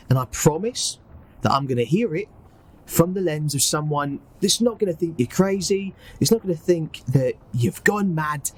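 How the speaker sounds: earnest, reassuring put-on Cockney; Cockney accent. [name] asking robot to tell everything, reassuring Cockney. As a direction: coax gently with a Cockney accent. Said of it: "do his best Cockney accent".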